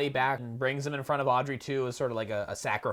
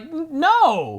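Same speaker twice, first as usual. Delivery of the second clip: higher, louder outrage; outraged Seymour; 9 semitones higher, 3 dB louder than usual. Retold or imitated. Seymour yelling at the plant that ate Audrey. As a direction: hurl it at the plant.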